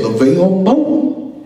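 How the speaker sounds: drawn-out, high-rising name call; retold name call. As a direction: imitate someone announcing a name, slow and high. Retold celebrity calling out his name.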